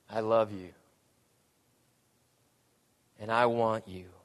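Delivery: low, hushed, intimate "I love you"; tender confession. Acted. God whispering love to runaway bride, low and tender. God's plea to Gomer voiced.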